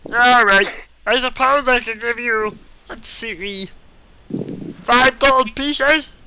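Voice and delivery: high loud squeaky elf; shouting muffled, pitched high; into a pillow. Acted. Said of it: "it sounds like I'm just shouting through a pillow".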